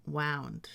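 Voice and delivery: "wound" with ow; wound as WOWND. Shown as a demonstration. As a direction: say "wound" to rhyme with "found". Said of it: "This one would be".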